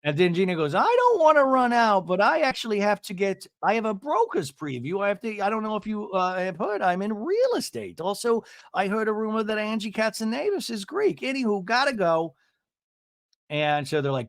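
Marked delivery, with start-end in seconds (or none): 0.7-12.3 high-pitched chatty impression of a realtor; mock busy realtor. Imitating a realtor excusing herself with gossip, mock self-important. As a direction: prattle on in a high put-on voice.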